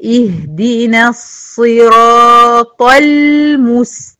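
slow chanted المستقيم, heavy ta and thin lam; correct tajweed. Shown as a demonstration. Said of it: "طه مفخمة واللام مرققة". (طاء مفخمة ولام مرققة)

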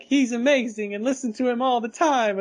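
put-on high, bright voice of a smitten girl; gushing admirer. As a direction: gush with starry-eyed praise. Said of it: "she's saying things like".

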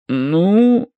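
hesitant. A narrator's reading. Priest avoids confirming the spirits, hesitant. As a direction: stall uneasily on one word. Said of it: "замялся преподобный".

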